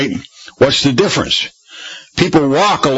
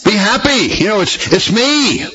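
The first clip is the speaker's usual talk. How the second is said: higher, lightly voiced reassurance; Jesus reassuring; 8 semitones higher than usual. Jesus calling to frightened disciples, higher reassuring voice. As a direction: soothe the scared men. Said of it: "And so Jesus says".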